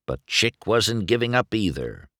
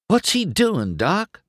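ordinary delivery

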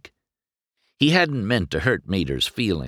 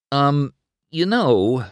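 awkward; 9 semitones higher, 4 dB louder, slower than usual. A narrator's reading. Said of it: "McQueen said awkwardly".

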